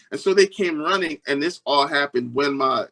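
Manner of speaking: ordinary talk, nothing marked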